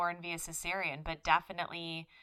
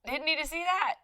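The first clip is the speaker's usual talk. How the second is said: startled oh; 11 semitones higher, 4 dB louder than usual. Retold or imitated. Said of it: "you can hear him go".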